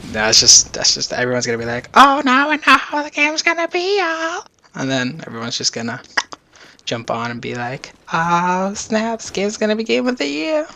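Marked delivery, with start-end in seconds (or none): 1.9-4.4 much higher, excited fan voice. Retold or imitated. Imitating hyped gamers seeing gameplay. 8.1-10.7 high, hyped-up fan voice; excited. Retold or imitated. Imitating hyped gamers jumping on.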